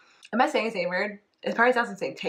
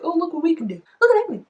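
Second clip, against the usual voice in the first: much higher, laughing show-off voice; 11 semitones higher, 4 dB louder than usual. Retold or imitated. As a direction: brag like a preening rookie. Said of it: "they really wanna show off".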